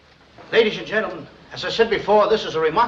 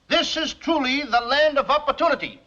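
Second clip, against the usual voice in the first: ordinary delivery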